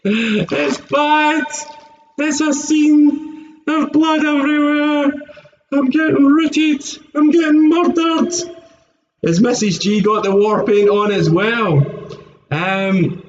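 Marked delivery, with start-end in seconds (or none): 0.0-8.3 hammed-up soldier. Hammed-up wounded soldier bit.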